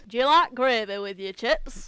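loud, hammed-up camp Scottish accent; a loud camp Scottish impression. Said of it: "very camp Scottish person".

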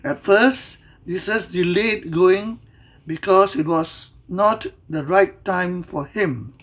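usual speaking voice

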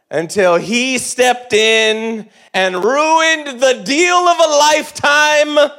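loud, high, slow ranting; mock fury. Teen raging that dad ruined his deal, loud high rant. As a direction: build into an exaggerated furious rant. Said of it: "and I was furious".

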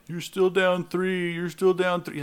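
mock the rival with a sneer; taunting fan.

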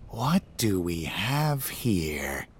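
slow, soft, menacing purr; sinister demon voice. A narrator's reading. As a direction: toy with them like a predator. Typhon, demonic shape-shifter.

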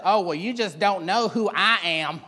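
drawn-out self-important lady; haughty lady. Acted. Lady pulling rank on people, haughty drawl. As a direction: play a slow, haughty woman. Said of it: "a lady like that and she says".

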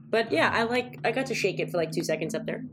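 ordinary talk

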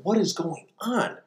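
high, slow, puzzled murmur; mock the stunned crowd, drawn out; baffled onlookers. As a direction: onlookers stunned by what they just heard. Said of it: "people are sitting there going".

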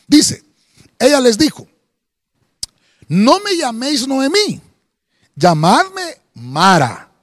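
loud, slow, weighty reading of Naomi's lament; booming scripture reading. Acted. Pastor reading Naomi's bitter words aloud, booming and slow. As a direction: proclaim each word heavily to the congregation. Preacher reads scripture at full force.

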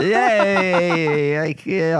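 high, laughing agreement. Real, not acted. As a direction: laugh through the yeahs. Teased about romance, laughing.

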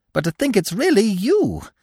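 high, bright squirrel delight. A narrator's reading. Squirrel to Bambi, high and delighted. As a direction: gush with pleasure. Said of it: "he went on delightedly".

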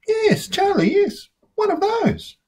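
Lola insisting. A narrator's reading. Sister insisting her brother pass a tomato, high and impatient.

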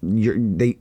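ordinary talk, nothing marked

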